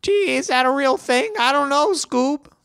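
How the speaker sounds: very high, strained cartoon slacker voice; Shaggy. Shaggy puzzling aloud to Scooby, high-pitched impression. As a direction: do a squeaky cartoon impression through laryngitis. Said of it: "That's my, that's my Shaggy with my freaking laryngitis voice".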